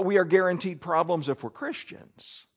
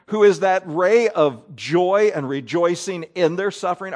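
ordinary talk

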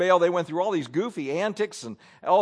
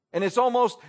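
normal talking voice

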